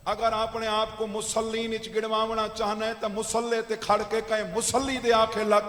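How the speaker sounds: forceful oratory growing to a big shout; preaching climax. Real, not acted. Preacher warning the would-be worshipper not to leave Ali, building to a shout. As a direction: swell to a loud, ringing finish.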